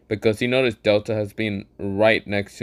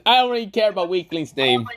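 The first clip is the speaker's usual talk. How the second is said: Gojo voice; 9 semitones higher than usual. Acted. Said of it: "Gojo says".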